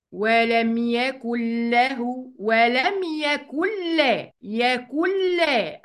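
very slow "ولم يكن له" as wa-lam yakull-lahu; nun merged into lam. Shown as a demonstration. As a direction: stretch "يكن لا" as yakull-laa. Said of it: "هلغي النون".

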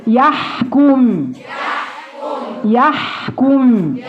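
يحكم very slow, the ha rubbed in the throat; rasped ha. Shown as a demonstration. Teacher drilling the ha sound in يحكم. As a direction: draw out يحكم and feel the ha scrape. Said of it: "وأحس بالحكة دي".

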